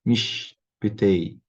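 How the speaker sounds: "Mishpitei" drawn out slowly, the sh left mute; "Mishpitei" said very slowly with a silent sh; slow syllable demo. Shown as a demonstration. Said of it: "Um X mudo".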